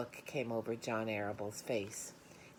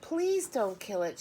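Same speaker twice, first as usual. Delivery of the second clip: high, louder pleading; girl begs her father; sobbing plea; 9 semitones higher, 6 dB louder than usual. A narrator's reading. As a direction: beg him not to kill it. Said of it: "she sobbed".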